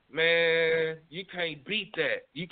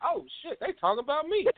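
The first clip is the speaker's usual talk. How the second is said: surprised; 9 semitones higher than usual. Retold or imitated. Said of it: "I was like".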